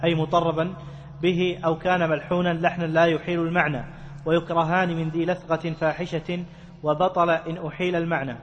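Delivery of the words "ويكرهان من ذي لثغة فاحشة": ordinary talk, nothing marked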